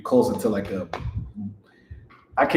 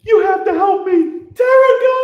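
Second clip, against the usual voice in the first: high, slow screaming plea; hysterical shout; 11 dB louder than usual. Acted. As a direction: scream it in full panic. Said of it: "Get that boy an Oscar".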